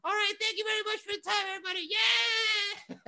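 very high squeaky puppet falsetto. Acted. Puppet thanks the booing audience and cheers, squeaky. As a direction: sign off in a tiny squeaky voice. Puppet's sign-off after the boos.

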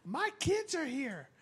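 high, slow, prissy outrage; indignant mother. Retold or imitated. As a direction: scold like an offended mom. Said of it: "she's like".

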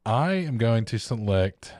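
slow, grand draft announcement; announcer voice. Acted. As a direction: announce the pick like a ceremony. Formal draft pick announcement.

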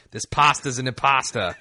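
put-on New York accent, laughing; mock New York dad accent. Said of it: "I can't tell what you're saying, Dad".

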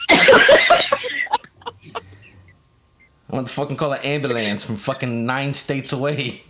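ordinary delivery

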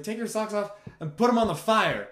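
high, loud shout; drunken yell. Retold or imitated. Drunk at the campfire, urging everyone, loud and high. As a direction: holler it, tipsy and gleeful. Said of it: "I was like".

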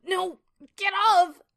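very high, drawn-out struggling scream; loud, strained high girl's cry. A narrator's reading. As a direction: scream to push the attacker off. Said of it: "She screamed out with it atop her".

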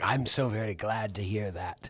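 low, slow, smarmy suitor voice; lightly voiced creepy charmer; a low slow mock of a smug suitor. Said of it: "he's like".

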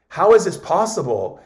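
mock astonishment, asking how it's possible; amazed. Jim Quick amazed after the demonstration, imitated disbelief.

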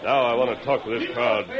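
normal talking voice

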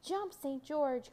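high, drawn-out warning call. A narrator's reading. Pinocchio warns the boy, high calling voice. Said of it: "called Pinocchio".

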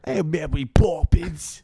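loud, high, drawn-out Cockney shout; exaggerated Cockney call, slow and loud; mock Cockney. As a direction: holler it in hammed-up Cockney. Imitating Cockney from film.

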